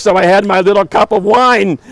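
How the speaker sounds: ordinary delivery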